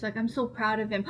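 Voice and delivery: normal talking voice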